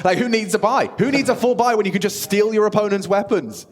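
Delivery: high, loud hyped play-by-play; esports caster. Acted. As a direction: scream the round like a caster.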